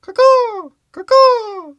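high, loud crow call; shrill bird imitation; crow caw. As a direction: caw like a bird to fool the cops. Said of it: "And he goes".

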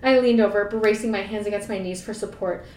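ordinary talk, nothing marked

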